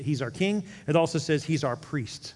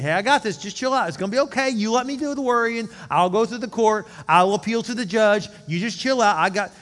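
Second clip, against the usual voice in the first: higher, louder, breezy reassurance; confident lawyer; 7 semitones higher, 7 dB louder than usual. Pretend. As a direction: sell it like a lawyer who has it handled. Good lawyer reassuring client.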